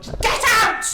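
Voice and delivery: screamed high and loud; a betrayed fiancée screaming at Robert to leave; furious shout. Acted. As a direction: explode at him. Fiancée throws him out.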